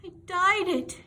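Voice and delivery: high, loud wail; despairing child wailing. A narrator's reading. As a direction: howl out the confession. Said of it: "Anne wailed".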